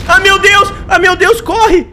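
loud, high panicked yelling while fleeing; panicked shout. Real, not acted. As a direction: shout in real fright to get away. (gritando em pânico)

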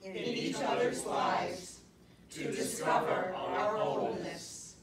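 slow, deliberate pledge spoken in unison; group recitation. Acted. Cast sharing a shared pledge before the stories, group recitation. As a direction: recite together, phrase by phrase.

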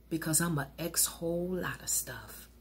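breathy confession whispered. Acted. Confessional aside in poem.

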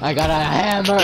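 excited shout. Real, not acted. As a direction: brag about a silly weapon. Mocking reply to the shotgun.

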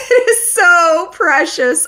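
high, slow squeal of delight; squealing gush. Real, not acted. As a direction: squeal it out adoringly. Gushing over fictional friendship.

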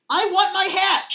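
loud, high demanding yell; high-pitched, full-voiced demand. A narrator's reading. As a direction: yell it like a frustrated sister. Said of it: "she yelled".

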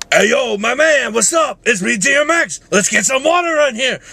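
high, shouted rapper impression. Vlogger doing a favorite voice, high shouting rapper. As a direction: belt it out like a hyped-up rapper. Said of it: "favorite voices to do is D M X".

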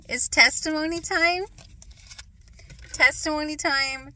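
high, bright chant of the phrase; sing-song announcement. Acted. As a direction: call it out like a cheer. Announcing testimony time, sing-song.

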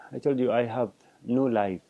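ordinary talk, nothing marked

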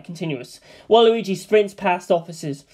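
ordinary delivery

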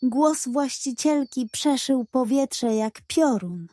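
ordinary delivery, nothing marked

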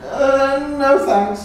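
frightened goat bleating no. Acted. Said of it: "he bleated".

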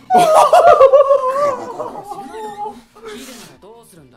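high loud burst of laughter. Real, not acted. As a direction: crack up loudly.

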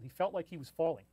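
ordinary delivery

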